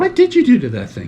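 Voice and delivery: high-pitched, incredulous demand; mock accusation. Real, not acted. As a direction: accuse your friend of wrecking it. Teasing accusation over broken car.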